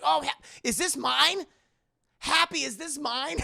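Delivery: high, loud rising question; awestruck teen. Retold or imitated. Imitating Peter asking Happy about the suit, high disbelief. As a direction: ask like a kid who can't believe it.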